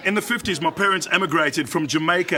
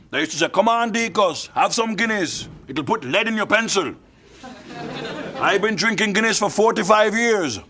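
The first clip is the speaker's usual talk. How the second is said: put-on old Jamaican man; 5 semitones higher than usual. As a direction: tell it as a proud elderly Jamaican. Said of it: "there's an old Jamaican guy in it".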